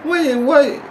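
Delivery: high-pitched, incredulous double-take; acted-out confusion, pitched well up; puzzled viewer doing a double-take; baffled reaction. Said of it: "you're like".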